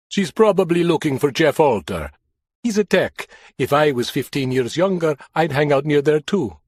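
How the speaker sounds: put-on high, teasing Jamaican accent. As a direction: tease him in a Jamaican accent. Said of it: "her charming Jamaican accent".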